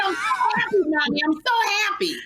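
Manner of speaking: high, gleeful mock squeal; imitated happy screaming child. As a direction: mimic an overjoyed child calling to mom. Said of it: "He was running around screaming".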